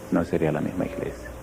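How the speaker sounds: low, soft settled reply; quiet answer. Acted. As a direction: let the answer drop down softly. Repeated answer, second whispered.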